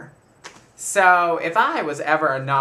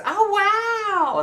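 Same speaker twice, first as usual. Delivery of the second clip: much higher, amazed aunt voice; high-voiced aunt; 4 dB louder than usual. Aunt reacting to the whole story, high amazed voice. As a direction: squeak it out as the stunned aunt. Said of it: "and she goes".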